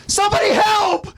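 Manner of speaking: high shouted cry for help; scream for help. Acted. Marco screaming for help while pinned. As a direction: yell for anyone to hear. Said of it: "tries screaming out".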